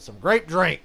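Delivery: Dave Chappelle bit. Retold or imitated. Quoting a comedian's bit, shouted loud. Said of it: "like Dave Chappelle said".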